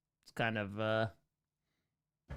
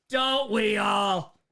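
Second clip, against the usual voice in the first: drawn-out exclaim; 4 semitones higher, 4 dB louder, much slower than usual. Pretend. Answering chat about chipped glass.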